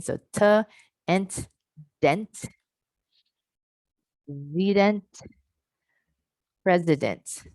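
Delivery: "T", "ent", "dent", "zedent", "president" built up syllable by syllable, each spaced; back-chain drill. Shown as a demonstration. Teacher back-chains president for the class, spaced. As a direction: build the word from the end. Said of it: "I add it in, in the back chain".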